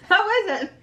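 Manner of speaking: laughing through the question. Real, not acted. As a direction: giggle as you ask. Asks again, laughing.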